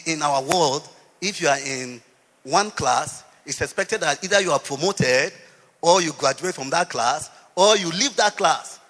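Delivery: loud, driving sermon voice; preacher building his case loudly; full-force preaching. Acted. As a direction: press the point on the congregation.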